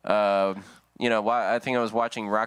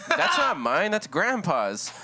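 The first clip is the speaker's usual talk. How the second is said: panicked blame; 7 semitones higher, slower than usual. Retold or imitated. Kid blaming grandpa.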